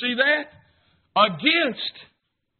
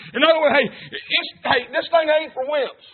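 normal talking voice

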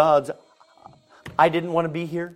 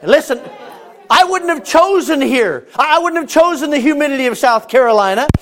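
higher, louder, slowed preaching cadence; preaching at full force; 10 semitones higher, 7 dB louder than usual. Acted. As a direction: preach it with building fervor.

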